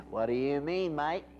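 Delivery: badly faked Aussie; mock-Australian salesman talk; ridiculous Australian accent. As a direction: put on an unconvincing Australian accent. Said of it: "you sound kind of funny".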